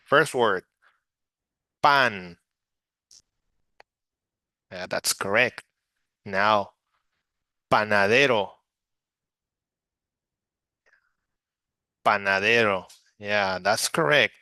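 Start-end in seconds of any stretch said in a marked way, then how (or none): 1.8-2.4 pan as one clear Spanish syllable; model word. Shown as a demonstration. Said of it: "please practice with me". 7.7-12.9 panadero, pa-na-DE-ro said clearly for the class; model word. Shown as a demonstration. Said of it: "please practice with me".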